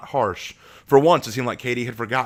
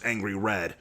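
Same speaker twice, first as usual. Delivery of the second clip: ordinary delivery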